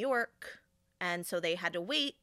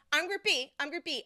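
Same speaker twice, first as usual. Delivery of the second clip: retold yelling; 6 semitones higher than usual. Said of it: "she starts yelling".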